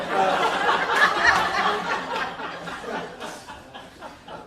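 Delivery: loud, high burst of laughter; hearty real laugh; big laugh. Real, not acted. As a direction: really laugh out loud at the joke. Preacher laughs at own joke.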